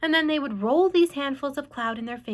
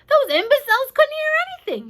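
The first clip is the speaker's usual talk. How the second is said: much higher, louder mocking cry; crying out with laughter; 7 dB louder than usual. A narrator's reading. Centipede mocking the cloud men, high and loud. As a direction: crow at them, laughing them off. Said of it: "he cried".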